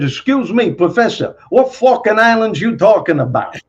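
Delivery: high, pushy Brooklyn voice; a Brooklyn wise guy needling the professor; Brooklyn friend. Retold or imitated. Brooklyn student challenging his history professor, high and pushy Brooklyn voice. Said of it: "my friend stands up and says".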